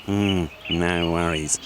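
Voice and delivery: low, slow sly rat hiss; greedy rats hissing. Acted. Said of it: "they hissed".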